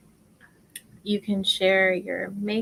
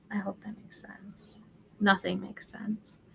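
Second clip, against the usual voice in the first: whisper; 10 dB quieter than usual. Real, not acted. Worried aside to viewers.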